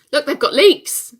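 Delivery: shrieking. Acted. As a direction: squeal with delight at finding the leeks. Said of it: "which involved me shrieking".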